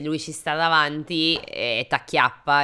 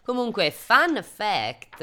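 ordinary delivery

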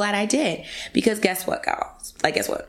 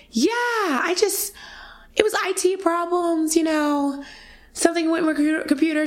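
fake cheerful; 9 semitones higher than usual. Covering up panic to colleague.

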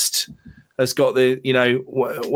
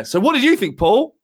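calling out to Paul; 9 semitones higher than usual. Real, not acted.

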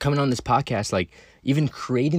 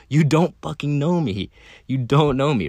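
louder, slow and emphatic, jabbing each word; defiant; 5 semitones higher, 5 dB louder than usual. Real, not acted. Telling online critics they don't know him, louder and defiant. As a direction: push back hard at the critics.

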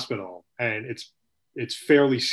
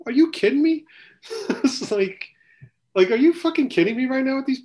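high pitched disbelief; are you kidding me, way up; outraged disbelief rising high; incredulous; 13 semitones higher, 3 dB louder than usual. Real, not acted. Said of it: "incredulous outburst".

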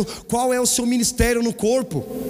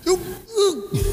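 mock sob; 11 semitones higher than usual. Crying out in remembered shame.

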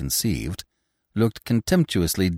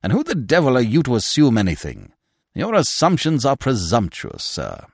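raised, higher indignant voice; indignant rebuke; 7 semitones higher, 5 dB louder than usual. A narrator's reading. Binet rebukes his new recruit for assuming, indignantly. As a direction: put the upstart in his place.

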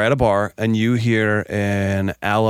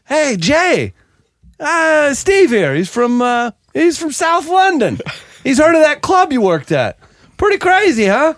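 high, cheery, louder matchmaking voice; chirpy bar introduction; 4 dB louder, slower than usual. Retold or imitated. Bar coworker introducing two Englishmen, cheery and high. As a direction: introduce two Brits like great news. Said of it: "my English buddy working there too".

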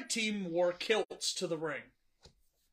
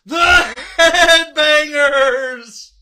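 slow, loud, drawn-out announcer call; 5 semitones higher, 13 dB louder, much slower than usual. Acted. Host reveals right answer.

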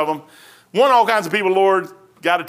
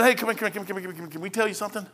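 gentle invitation; 10 dB quieter, much faster than usual. Retold or imitated. Couple inviting Apollos in.

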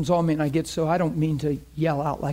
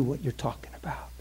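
hushed; 2 semitones lower, 9 dB quieter than usual. Real, not acted. Preacher drops to hushed emphasis.

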